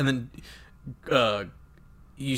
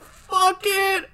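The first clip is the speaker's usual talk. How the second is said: very high, loud mock cry; hammed-up scream of giving up; desperate outburst; 5 dB louder than usual. Captain giving in and shooting himself, mock desperate.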